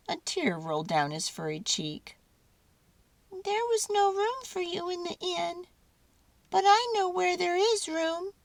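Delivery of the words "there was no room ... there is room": high, squeaky mouse voice; a tiny mouse offering his home. A narrator's reading. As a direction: mouse speaking to the baby statue. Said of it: "he said".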